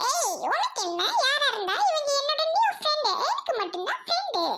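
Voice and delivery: high put-on character voice; possessive squabble. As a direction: claim the friend in a high squeal. Possessive quarrel over new friend. (உச்சக் குரலில் உரிமைச் சண்டை)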